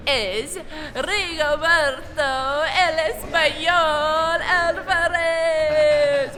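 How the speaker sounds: slow, loud ring-announcer shout; announcer intro. Real, not acted. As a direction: call out his name like a fight announcer. Introducing a boxing champion.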